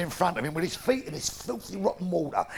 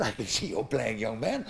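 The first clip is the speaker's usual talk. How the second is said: deep, quiet churchman's voice; low vicar voice; 11 semitones lower, 8 dB quieter than usual. Acted. Vicar questioning the little boy, low and quiet. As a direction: speak low and soft as the vicar.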